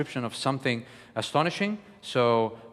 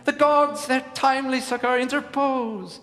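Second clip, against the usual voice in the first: excitement; 10 semitones higher than usual. Shown as a demonstration. Coach demonstrating joy to students, exhilarated and high. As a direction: burst out as if the gods have finally come.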